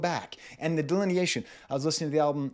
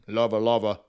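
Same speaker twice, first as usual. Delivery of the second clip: low, loud reggae-singer voice; 5 semitones lower, 5 dB louder than usual. Retold or imitated. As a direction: drop low and project like a reggae star. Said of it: "the reggae singer [name]".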